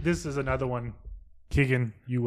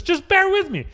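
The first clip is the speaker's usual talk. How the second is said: high reading voice; robot reading voice; 8 dB louder than usual. Real, not acted. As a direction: read it off the page stiffly. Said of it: "if I sound like a robot reading this".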